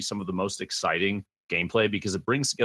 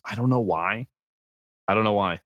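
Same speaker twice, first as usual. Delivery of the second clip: usual speaking voice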